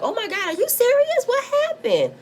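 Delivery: high, squealing fake shock; mock surprise. Imitating Monique's fake shock to the camera, high mock surprise. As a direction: mimic her put-on astonishment. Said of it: "Monique acting all surprised".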